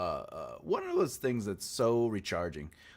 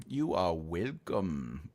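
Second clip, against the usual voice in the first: low mock voice; 9 semitones lower, 5 dB quieter, much slower than usual. Low put-on reply.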